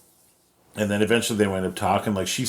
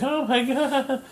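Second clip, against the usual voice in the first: very high, slow imitated gush; hammed-up starstruck squeal; mock swoon; slower than usual. Gwenpool seeing dreamy Doctor Strange, high mock swoon. Retold fan swoons over handsome man.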